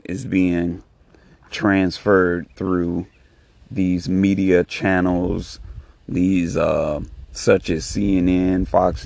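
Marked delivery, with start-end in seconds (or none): none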